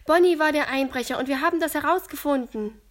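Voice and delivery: schnell und laut herausgesprudelt; aufgeregt. A narrator's reading. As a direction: vor Aufregung schnell berichten. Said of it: "berichtet Luis aufgeregt". (Luis erzählt Iris aufgeregt, schnell und laut)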